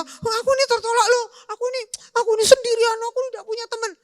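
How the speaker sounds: high, fast mocking whine; mock a lonely teen feeling sorry for themselves; whiny self-pity. Preacher mocking a rejected teen, high whiny self-pity.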